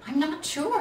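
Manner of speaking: a tiny unsure little bear. A narrator's reading. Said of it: "answered the little bear".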